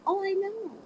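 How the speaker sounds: high, breezy 'of course'; lightly voiced child, pitched well up; self-assured child. Child accepting a stranger's compliment, breezy and sure.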